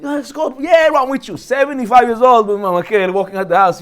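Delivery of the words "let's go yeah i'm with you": put-on eager young guy. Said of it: "a little guy coming".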